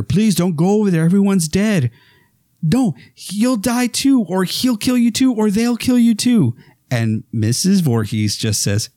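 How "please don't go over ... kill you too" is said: panicked warning. Retold or imitated. Final girl warns the killer mother, high and frightened. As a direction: beg her not to go, terrified. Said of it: "Alice is warning her".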